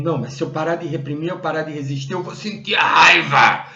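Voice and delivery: hurried objection bursting into a loud cry; alarmed protest. Retold or imitated. People objecting that they will feel anger, alarmed protest. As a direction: imitate people panicking, ending in a shout. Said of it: "aí as pessoas dizem".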